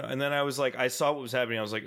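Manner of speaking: ordinary talk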